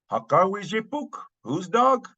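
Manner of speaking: "Haka wijipuk" and "Whose dog?" pitched high; questioning. Acted. Acted question in example exchange.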